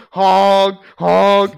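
loud mock truck horn honks; honking. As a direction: blare a honk like a truck horn. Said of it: "it is much louder than that".

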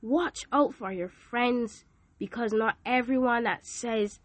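ordinary delivery

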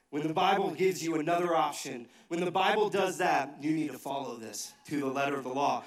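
normal talking voice

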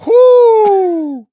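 high, loud whoop; excited whoop. Real, not acted. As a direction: let out a big excited whoop. Reacting to ten pounds gained.

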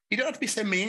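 high, lightly whiny friend; imitating Gareth. Mocking Gareth's gentle scolding, high mild whine. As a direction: pitch up to mock a gentle scolding. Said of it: "Gareth's just like".

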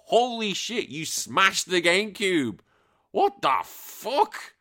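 high, slow stunned outburst; disbelief. Real, not acted. Host stunned by the smashed GameCube, incredulous. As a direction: stretch it out in shock. Reacting to viewer's confession.

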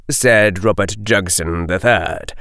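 usual speaking voice

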